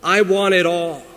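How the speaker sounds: demanding. Retold or imitated. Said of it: "he demanded to have more".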